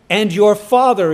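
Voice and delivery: "Father" hit loud and firm; preacher driving home "your Father"; stress on Father. Retold or imitated. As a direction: lean hard on "your Father". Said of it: "Jesus emphasizes this".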